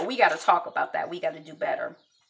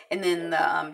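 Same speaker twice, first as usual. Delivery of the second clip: normal talking voice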